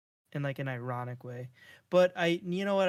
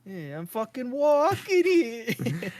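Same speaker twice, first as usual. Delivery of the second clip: loud, slow, laughing tough-guy Italian; Jersey Italian, laughing; Italian-American accent; 8 semitones higher, 7 dB louder, much slower than usual. Acted. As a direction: ham up a New York Italian with a curse. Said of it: "do an Italian accent".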